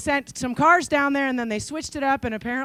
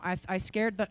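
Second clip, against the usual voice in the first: ordinary talk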